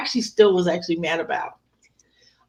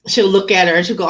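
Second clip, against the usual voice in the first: normal talking voice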